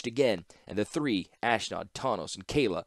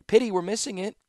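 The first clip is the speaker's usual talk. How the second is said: much higher, louder mock-light female voice; sardonic woman; 12 semitones higher, 5 dB louder than usual. Ashnod teasing Tawnos about the battle, high and sardonic. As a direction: float it up, unbothered.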